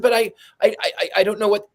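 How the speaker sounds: high, stammering mock protest; flustered stammer. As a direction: play the panicked beginner tripping on words. Her past self protesting flustered.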